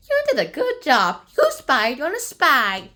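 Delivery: loud, lightly acted congratulation; relieved praise. Lady Sorton praises the child's spying, relieved praise. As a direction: praise loudly, slowing into the last words. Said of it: "Lady Sorton was relieved, saying".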